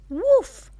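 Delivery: high, loud playful woof; puppy barks. Acted. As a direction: yip like a little puppy. Mom voices the puppy.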